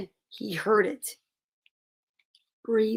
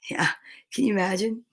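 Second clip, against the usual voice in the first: ordinary delivery, nothing marked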